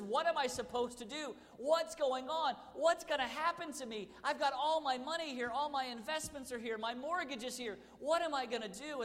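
high, loud panicked rant; frantic bank customer ranting at the teller; ranting and raving. Retold or imitated. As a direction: rave at the teller in a shrill panic. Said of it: "began to, to rant and rave".

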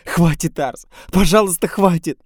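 woman begging him to stop, crying; sobbing pleas. A narrator's reading. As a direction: beg through tears. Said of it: "начинает она рыдать".

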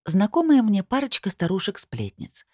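ordinary delivery

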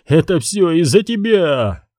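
high accusing cry; shouting. Acted. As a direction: blame him at full voice. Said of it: "крикнул он".

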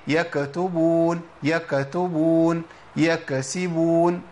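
يكتبون and يكسبون very slow, the k shaken with an echoing bounce; wrong rattled k. Shown as a demonstration. Teacher showing the wrong k vibration. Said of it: "ما ينفعش".

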